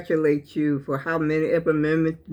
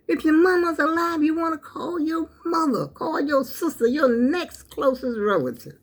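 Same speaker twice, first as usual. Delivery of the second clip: pleading as the emotion takes over; choked up; 9 semitones higher than usual. Real, not acted. Urging listeners to call family, real tearful emotion. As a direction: voice rising high, close to tears. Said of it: "I'm getting really carried away about this family".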